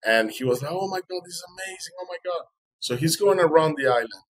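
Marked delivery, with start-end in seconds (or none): 0.7-2.5 high, hushed amazement; awed. Retold or imitated. Fisherman watching the dolphin follow the boat, awed. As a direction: marvel softly in a raised pitch.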